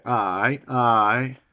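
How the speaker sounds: lightly imitated Newcastle "aye"; a hint of Newcastle accent; Newcastle dialect. Shown as a demonstration. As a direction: say a drawn-out Geordie "aye". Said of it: "for example, Newcastle".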